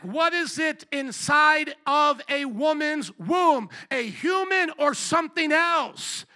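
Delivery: slow, loud, high-pitched interrogation; forceful challenge. Retold or imitated. Retells his challenge to lawyer.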